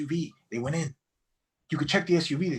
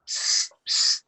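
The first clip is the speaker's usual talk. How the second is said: unvoiced hissed psst; sharp little "psst" to get attention; attention-getting psst. Player signalling to partners in the game, hissed psst.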